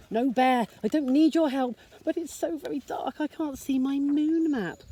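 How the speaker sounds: high little voice, stubborn but scared; a tiny mouse insisting it can cope; small anxious mouse. Acted.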